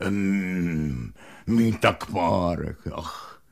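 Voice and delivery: low, slow longing murmur; dreamy hungry dog. Acted. Hungry dog Alík longing for a sausage, low murmur. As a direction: sigh out the craving for a sausage.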